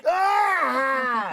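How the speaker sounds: high, loud cry of pain; pained shout. Retold or imitated. Ankle twisting on the hill, loud pained cry. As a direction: yell as the ankle gives way. Landing wrong on his ankle.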